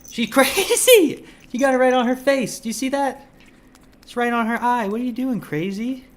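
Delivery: high, loud, amused exclaiming; laughing excitedly. Real, not acted. Streamer laughing at his frantic cat smeared with treat.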